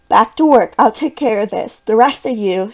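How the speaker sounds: firm, forceful orders; brisk instructing. A narrator's reading. Teacher sending the scenery crew back to work, instructing firmly. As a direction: take charge, punching the first words. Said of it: "Miss Walker instructed".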